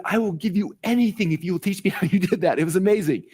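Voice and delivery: mock-beg with gushing amazement; eager begging. Student begging mentor to teach him how, eager plea.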